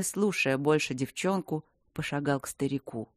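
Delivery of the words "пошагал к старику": ordinary delivery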